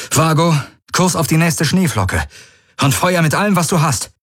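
high-pitched barked order to the ship; urgent command. Acted. Galen orders Vago to fire everything, urgent high command.

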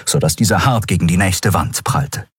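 ordinary delivery, nothing marked

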